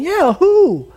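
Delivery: high, loud feigned innocence; squeaky mock-innocent villain; mock innocent Haman. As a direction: play Haman acting clueless. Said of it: "And Haman's going".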